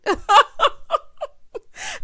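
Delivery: loud squealing laugh; high shrieking laugh. Real, not acted. Vlogger laughing at her own joke, high shriek. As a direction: let out a high cackle. Joking about jail cell.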